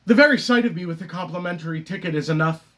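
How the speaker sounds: rapid stage aside; hurried critic mid-monologue; theatrical Moon monologue. Acted. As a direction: rattle it off in one quick rush. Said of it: "the character Moon".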